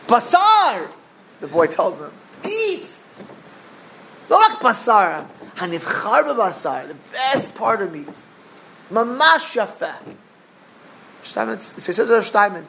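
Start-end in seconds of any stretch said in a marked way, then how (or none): 0.1-0.9 "Basar!" called out high and loud; eager shout. Acted. Boy urging the old sage, loud and eager. 2.4-10.0 put-on eager boy. Boy praising steak to the sage.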